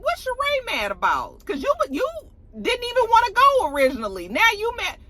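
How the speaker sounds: very high exasperated disbelief; can't believe this. Real, not acted. Baffled at Sheree's anger.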